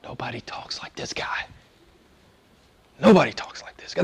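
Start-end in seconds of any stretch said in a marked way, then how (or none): 0.0-1.5 hushed, whispered acted reply; very soft mock-awed answer. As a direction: whisper it like an awestruck guard.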